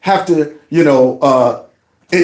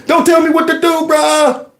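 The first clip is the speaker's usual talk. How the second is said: defiant bro; 10 semitones higher than usual. Retold or imitated. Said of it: "playing the clip of this man going".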